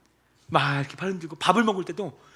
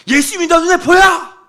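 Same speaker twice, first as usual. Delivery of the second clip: higher, louder furious demand; angry shout; 13 semitones higher, 14 dB louder than usual. Retold or imitated. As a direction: shout it in exasperation. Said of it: "그 목사님 열받아서".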